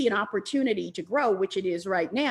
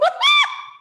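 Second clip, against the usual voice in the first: real overjoyed laughing squeal; high delighted squeal-laugh; 9 dB louder than usual. Real, not acted. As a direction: shriek of laughter, very high and loud. Overcome by the hearts.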